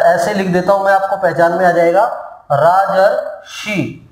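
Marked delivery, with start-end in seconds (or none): 2.5-4.1 राजर्षि drawn out slowly, the र clearly heard; slow model word. Shown as a demonstration. Teacher slowly sounds out राजर्षि for students. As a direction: stretch राजर्षि so each sound shows. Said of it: "उच्चारण करके देखेंगे".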